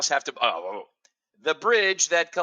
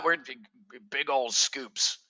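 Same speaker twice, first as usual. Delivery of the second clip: ordinary delivery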